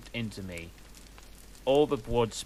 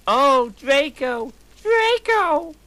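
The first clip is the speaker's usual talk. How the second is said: much higher, louder, drawn-out hammed-up scream; 11 dB louder, slower than usual. Ebony crying out to Draco in bed, hammed-up scream. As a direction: wail his name up high and long. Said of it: "I screamed while getting an orgasm".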